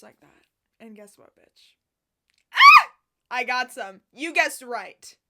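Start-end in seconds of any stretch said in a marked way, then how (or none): 2.5-2.9 high, loud scream of delight; joyful scream. Real, not acted. Vlogger screaming over her new boots. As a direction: scream with joy.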